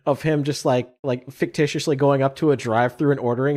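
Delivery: usual speaking voice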